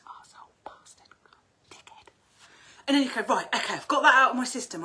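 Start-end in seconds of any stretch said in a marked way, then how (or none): 0.1-2.1 breathy whisper of the swear words; hushed whispered insults. Shown as a demonstration. 3.2-4.8 relieved outburst. Shown as a demonstration. Relieved after swearing it out.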